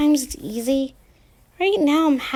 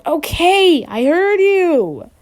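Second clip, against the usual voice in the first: yelling off the phone; 7 semitones higher, 4 dB louder, slower than usual. Acted. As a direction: call out loud to his father away from the receiver. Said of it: "yells away from the phone".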